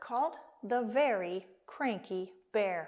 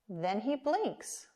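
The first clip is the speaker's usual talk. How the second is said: plain reading voice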